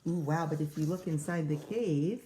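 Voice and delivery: ordinary delivery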